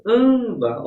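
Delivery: "âmbar" with a high, loud ÂM; loud, high ÂM-bar for "âmbar"; stress on penultimate syllable. Shown as a demonstration. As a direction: punch the first syllable of "âmbar". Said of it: "a sílaba tônica aqui é a penúltima".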